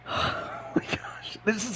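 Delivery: breathless whispered awe; awed whisper. Real, not acted. Awed by the game screen.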